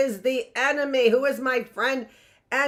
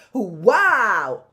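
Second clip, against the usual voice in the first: louder, amazed wow!; be truly impressed; louder mock amazement; excited wow; 6 dB louder than usual. Shown as a demonstration. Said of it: "Or excited".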